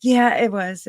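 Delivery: ordinary delivery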